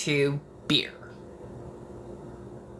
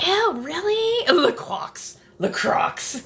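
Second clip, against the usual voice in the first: mock valley girl, pitched up and drawn out; a teasing put-on valley girl sneer; 10 semitones higher, slower than usual. Mocking La Croix to her co-host in a valley girl voice. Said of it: "why is this bringing out the valley".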